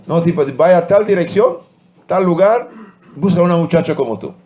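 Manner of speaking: a hint of an eager youth pressing directions; young man's urging. A hint of a young man telling the girl where to go, eager.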